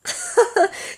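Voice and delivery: high burst of embarrassed laughter. Real, not acted. Laughing at her mix-up of pastry and hospital: embarrassed laugh. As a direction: laugh at your own blunder.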